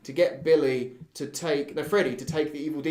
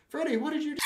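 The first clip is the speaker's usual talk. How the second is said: horrified friend; faster than usual. Acted. Dismayed friend voice.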